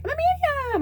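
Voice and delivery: very high, squeaky self-parody; overeager announcement. Retold or imitated. Parodying how she once introduced herself to people, overeagerly. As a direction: blurt it in a tiny high voice.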